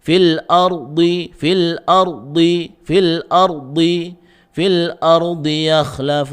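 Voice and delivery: "fil ardi" slow with heavy ra. Shown as a demonstration. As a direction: repeat "fil ardi" deliberately. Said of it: "karena dad istilah".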